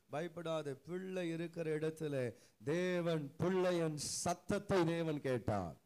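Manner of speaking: loud sermon delivery, building to a peak; forceful preaching. Acted. Preacher retelling the angel's call to Hagar, forceful preaching. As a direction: preach it out loud, dropping on the final words.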